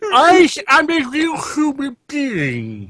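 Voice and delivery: slow, high, wailing plea; hammed-up tormented cry; Elephant Man. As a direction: drag it out in tearful mock anguish. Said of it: "have you seen the Elephant Man film?".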